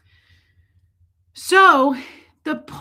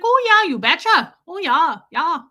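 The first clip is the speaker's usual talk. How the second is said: high, loud, put-on Canadian "you betcha" accent; hammed-up Canadian accent, high and lilting; Fargo-style Canadian accent; 5 semitones higher, 6 dB louder than usual. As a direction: imitate a folksy Fargo accent, pitched well up. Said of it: "This isn't Frances McDormand in Fargo".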